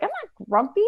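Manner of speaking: high-pitched huffy protest; grumpy denial. Acted. Grumpy monkey denies it to snake, huffy. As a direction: insist grumpily, voice jumping up. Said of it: "he's so grumpy".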